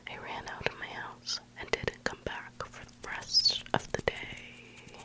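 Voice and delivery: usual speaking voice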